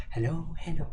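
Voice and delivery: high, soft mock Swedish voice; Swedish accent. Streamer tries the Swedish voice for chat, softly Swedish-accented. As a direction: greet in a put-on Swedish accent. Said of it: "in my Swedish voice".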